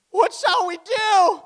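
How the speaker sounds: high, loud, drawn-out wail; hammed-up alarmed shout; exclaimed outcry. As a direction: cry it out with a big exclamation mark. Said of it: "Exclamation mark".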